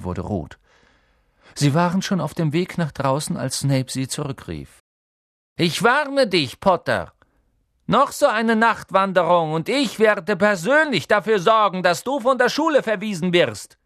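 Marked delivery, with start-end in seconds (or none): 5.6-13.8 loud, high-pitched menacing warning; stern teacher at the end of his patience; angry threat. A narrator's reading. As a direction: drive the threat home forcefully. Teacher threatens pupil with expulsion.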